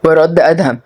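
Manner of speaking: usual speaking voice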